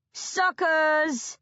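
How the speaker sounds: loud mocking bellow. A narrator's reading. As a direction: jeer it down from above. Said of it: "Ivor bellows".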